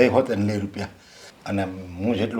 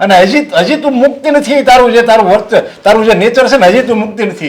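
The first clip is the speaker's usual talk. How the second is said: higher and louder, hurling accusations; angry shouting; 11 semitones higher, 13 dB louder than usual. Acted. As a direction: rant at her, blaming everything on her. Father shouting at daughter.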